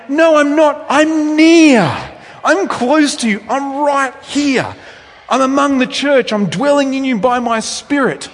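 high, loud, pleading I'm-near voice; Jesus insisting. Retold or imitated. Jesus telling distant believers he is near, voiced high and loud. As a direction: call it out as Jesus reassuring. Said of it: "Jesus keeps saying".

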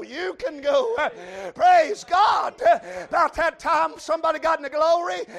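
loud revival preaching, rising and ringing; preaching at full force. Acted. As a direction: preach it hard to the congregation. Preacher shouting the sermon.

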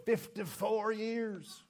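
slow, high-pitched Southern drawl. Retold or imitated. Long-married husband answering the pastor, in a Southern drawl. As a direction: stretch it out in a Southern accent. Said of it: "in that southern drawl".

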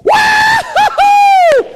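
put-on high, loud child's shout of delight; imitating a joyful child's wahoo; little girl's cheer. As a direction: squeal it like a little girl who just got her wish. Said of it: "I heard a little girl say".

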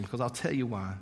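ordinary delivery

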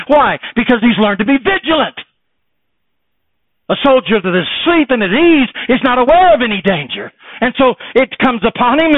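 high, loud, driving preacher's cry; preaching at full force. Acted. Preacher warning the congregation of Satan, loud and high. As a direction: hammer the warning home to the church.

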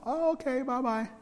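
high, light oh okay bye-bye; lightly voiced disappointed girl; girl's reply. Girl answers his excuse.

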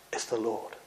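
hushed and low, full of wonder; John's hushed recognition; awed hush. Acted. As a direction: breathe it out in awe. Awed disciple recognises Jesus.

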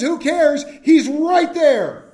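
joyful, awed outburst; excited. Real, not acted. Joyful exclamation about Jesus.

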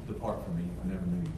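quiet, grave pronouncement; hushed verdict. Acted. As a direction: say the sentence almost under the breath. Quoting Christ's judgment quietly.

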